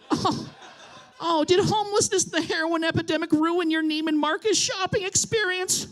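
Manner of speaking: mock their troubles with fake pity; mounting mock anger. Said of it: "I just feel myself getting angry".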